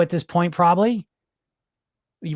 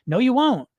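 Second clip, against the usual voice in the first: ordinary talk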